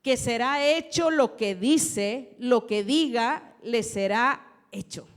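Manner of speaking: loud, slow preaching of the verse; preaching scripture. Acted. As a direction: proclaim each word to the congregation. Preacher reading scripture aloud.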